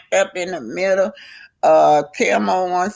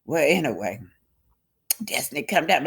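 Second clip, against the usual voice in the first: plain reading voice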